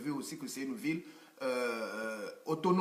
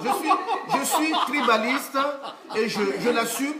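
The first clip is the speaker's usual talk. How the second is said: louder and higher, laughing through the words; proud laughing outburst; 7 semitones higher, 8 dB louder than usual. Real, not acted. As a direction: shout out the confession with a laugh, owning it. Guest proudly claims being tribalist.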